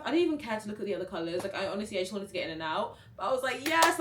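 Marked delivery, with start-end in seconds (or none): 3.6-4.0 loud, high burst of joy; happy exclamation. Retold or imitated. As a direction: re-enact a delighted yes, punched out loud. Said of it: "I was so happy".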